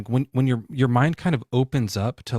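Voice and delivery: ordinary talk, nothing marked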